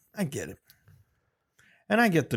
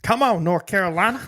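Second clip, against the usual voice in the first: Gronk impression; 6 semitones higher, 3 dB louder than usual. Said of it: "Rob Gronkowski".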